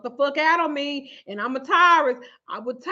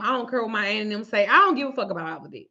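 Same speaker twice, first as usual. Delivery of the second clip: normal talking voice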